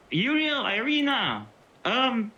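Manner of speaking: high-pitched calling out, not full volume; mock scream. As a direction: call out for help in a pretend scream. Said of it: "then I'll just scream".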